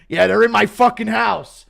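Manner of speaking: loud frantic complaint; panicked call to police. Retold or imitated. Demented old man calling police about his own family. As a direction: report the intruders in a panic.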